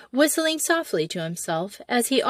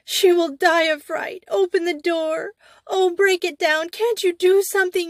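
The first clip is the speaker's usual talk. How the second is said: high, frantic wail of a terrified mother; 9 semitones higher, 3 dB louder than usual. A narrator's reading. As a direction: wail it much higher, out of your mind with fear. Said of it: "wailed the mother".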